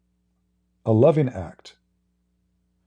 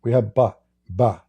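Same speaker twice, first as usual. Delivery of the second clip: ordinary talk, nothing marked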